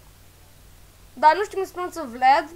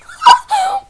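burst of laughter; 11 dB louder than usual. Real, not acted. Laughing at own attempt.

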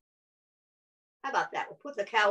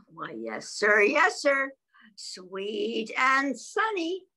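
bee answers; much slower than usual. Acted. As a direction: start hushed then bounce up.

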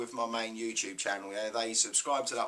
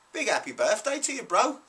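high, bright birthday cheer, a bit louder; excited birthday call; 10 semitones higher, 4 dB louder than usual. Real, not acted. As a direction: call out the birthday wish with a big grin. Birthday shout-out to a mate.

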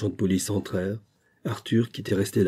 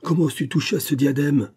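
loud, higher furious accusation; angry outburst; 6 semitones higher, 4 dB louder than usual. A narrator's reading. Father shouting at son holding the diadem, furious. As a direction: hurl the insults at the thief. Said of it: "criai-je".

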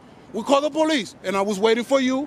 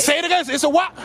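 higher, pointed demand to repeat it; goading challenge; 3 semitones higher than usual. Real, not acted. Man filming makes officer repeat "it's a public", goading challenge. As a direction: pounce on the admission, pitch raised. Replay of pouncing on admission.

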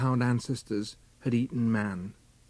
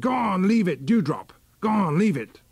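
higher, louder command to a hound; cried out; 5 semitones higher, 3 dB louder than usual. A narrator's reading. Huntsman crying to hound Dewdrop at the holt, loud. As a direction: call the hound off sharply, leaning on leave. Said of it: "a voice cried just over Tarka's head".

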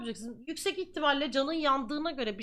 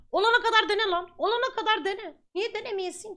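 fired-up urging; 7 semitones higher, 4 dB louder than usual. Real, not acted. Exhorting viewers to keep trying.

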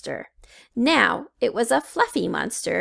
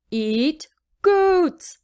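higher hungry monster voice; greedy little beast; eager monster; fluffy monster; 6 semitones higher, 4 dB louder than usual. A narrator's reading. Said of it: "said the fluffy monster".

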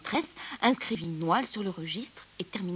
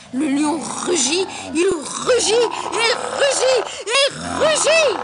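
roared-out narration rising louder and higher; building roar; 9 semitones higher, 12 dB louder, much slower than usual. A narrator's reading. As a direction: draw out each rugit, bigger every time. Narrator enacts repeated roaring. (récit rugi, de plus en plus fort et aigu)

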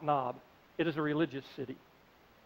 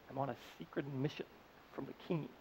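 secretive hush; 5 semitones lower, 14 dB quieter than usual. Retold or imitated. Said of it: "David answered".

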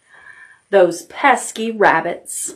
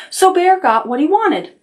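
usual speaking voice

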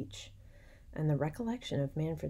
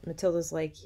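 ordinary delivery

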